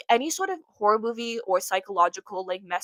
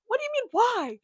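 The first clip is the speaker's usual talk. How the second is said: incredulous retort; 14 semitones higher than usual. Retold or imitated. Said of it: "her own retort quoted".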